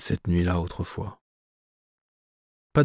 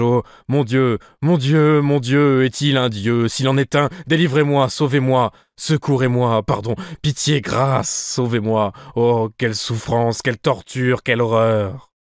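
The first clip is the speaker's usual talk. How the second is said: higher and louder, a frantic cry for mercy; desperate plea; 4 semitones higher, 3 dB louder than usual. Acted. Possessed man calling on God to save him, desperate cry. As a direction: beg heaven for rescue, voice climbing in panic. Narrator cries to God in terror.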